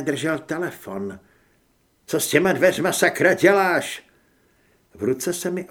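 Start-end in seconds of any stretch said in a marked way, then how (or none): 2.1-4.1 loud, raised-pitch challenge; shouted demand. A narrator's reading. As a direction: yell an indignant question down the corridor. Grant shouts across the corridor. (rozčilený křik)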